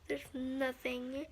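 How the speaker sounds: ordinary talk, nothing marked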